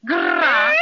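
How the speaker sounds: loud, high growling roar to scare off a spider; fierce bear growl. Acted. Bear growling at the spider who made faces. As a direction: let rip a big bear growl. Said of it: "one special growl at this spider".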